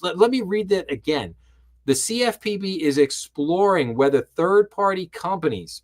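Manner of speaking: usual speaking voice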